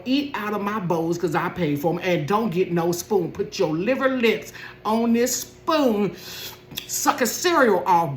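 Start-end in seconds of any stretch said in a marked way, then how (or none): 3.3-8.1 slow, high mocking taunt. Mom taunting her son over his cereal, mocking. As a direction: drag it out to rub it in.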